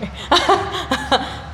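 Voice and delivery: high unvoiced laugh; breathy laugh. Real, not acted. Laughing about parents' support.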